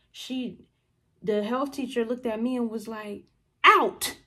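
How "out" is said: loud, high one-word command; teacher's order. Retold or imitated. Health teacher to bottle thrower, loud order. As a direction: imitate a teacher throwing you out.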